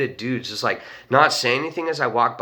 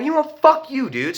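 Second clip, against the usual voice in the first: high-pitched retold outburst; mock-angry, voice jumping up; indignant telling-off; 13 semitones higher than usual. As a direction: act out storming off in protest. Retold quarrel with cab driver.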